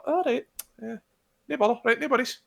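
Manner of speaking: high-pitched mum saying fine; imitated mum. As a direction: pitch up like an easygoing mum accepting an excuse. Imitating the mum's easy reply.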